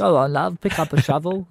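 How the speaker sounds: half-hearted mock Cockney farmer; Cockney attempt. Co-host trying out a UK farmer's accent, rough Cockney. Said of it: "It's kinda Cockney".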